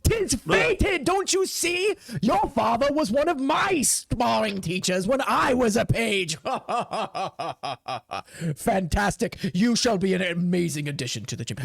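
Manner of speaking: hammed-up jolly commander with big laugh. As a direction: exclaim jovially to a new recruit.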